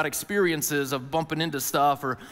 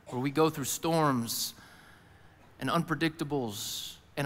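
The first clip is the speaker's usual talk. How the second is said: quiet reflective preaching; 3 semitones lower, 4 dB quieter than usual. Acted. Reflective sermon close.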